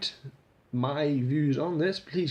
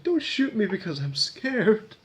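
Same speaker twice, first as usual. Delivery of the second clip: imitating a scared mother; 8 semitones higher, 4 dB louder, slower than usual. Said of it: "she can't prove who she is 'cause she's so scared".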